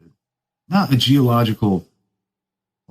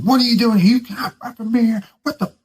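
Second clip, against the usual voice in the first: much higher imitated yell; mock yell; 10 semitones higher than usual. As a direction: yell it like the jacked officer. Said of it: "at the top of his lungs".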